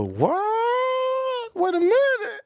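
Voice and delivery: high squealed disbelief; comic high-pitched double take; mock shock. Retold shock at wine request.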